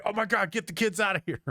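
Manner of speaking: high-pitched mock panic; freaked-out parents. Parents freaked out by the opening scene, mock panic. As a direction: imitate an alarmed parent hurrying children away. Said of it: "it freaked all the parents out".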